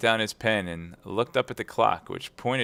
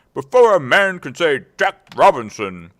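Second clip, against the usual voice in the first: put-on loud, higher hearty old master; jovial Fezziwig; 6 semitones higher, 12 dB louder than usual. A narrator's reading. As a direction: boom out with delight. Fezziwig voiced in story.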